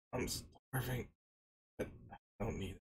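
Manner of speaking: faint, very soft, trailing voice; weak from hunger. Retold or imitated. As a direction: barely get it out, starving but proud. Starving beaten man refuses help.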